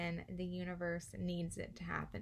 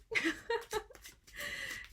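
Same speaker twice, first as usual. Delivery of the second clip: squealing laugh, much higher; giddy high laugh. Real, not acted. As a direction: burst into delighted giggles. Giddy laugh after ice skating.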